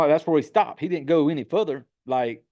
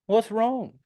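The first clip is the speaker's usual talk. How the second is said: stupid-sounding question; 8 semitones higher than usual. Retold or imitated. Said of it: "stupid the way he said it".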